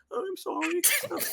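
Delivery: very high, slow squeaky character voice; comic high-pitched drawn-out sorry; mock apology. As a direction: apologize in a silly falsetto. Voices the creature's reply in yarn.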